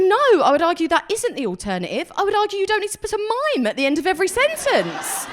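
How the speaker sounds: high, laughing indignant comeback; indignant retort. Retold or imitated. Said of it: "And I was like".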